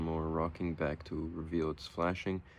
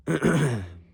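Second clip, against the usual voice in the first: sharp loud throat clear; 9 semitones higher, 9 dB louder than usual. Real, not acted.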